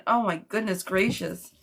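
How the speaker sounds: ordinary talk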